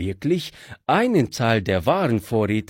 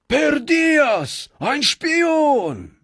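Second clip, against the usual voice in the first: angry bandits; 11 semitones higher, slower than usual. Acted. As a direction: leap up and yell at the spy. Bandits leap up at prisoner.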